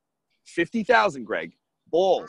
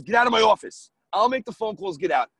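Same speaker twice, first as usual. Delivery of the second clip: louder, curt order to leave; angry dismissal; 5 semitones higher, 5 dB louder than usual. Retold or imitated. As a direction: order them out of the office. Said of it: "pushed us out".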